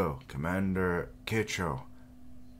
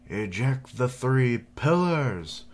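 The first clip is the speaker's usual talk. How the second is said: loud, higher, slow command; commanding order; 7 semitones higher, 7 dB louder, slower than usual. Acted. Commander ordering Fujin to eject the pillars, loud and slow. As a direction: proclaim the order grandly.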